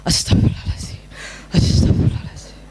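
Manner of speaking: whispered prayer under the breath; hushed, self-restraining whisper; breath-drawing self-calming. Acted. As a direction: breathe out and whisper it to steady yourself. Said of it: "udah tarik nafas".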